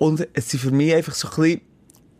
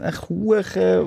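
slow repeat; 3 semitones higher, much slower than usual. Real, not acted. Repeats partner's word slowly.

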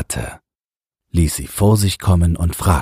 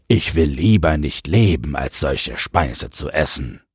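plain reading voice

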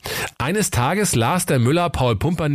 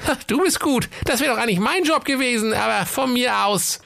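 shrill squeaky housewife voice, much higher; high falsetto wife. Acted. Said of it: "seine Frau sprach".

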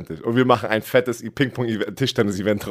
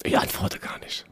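whispered, soft aside; hushed, voiceless whisper; conspiratorial whisper; 9 dB quieter than usual. Acted. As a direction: whisper it like a secret. Comic aside about ignoring interview requests.

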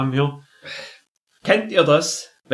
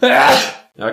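sneezing; 11 semitones higher, 6 dB louder than usual. Real, not acted. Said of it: "Ich muss niesen".

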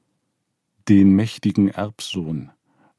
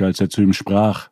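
plain reading voice

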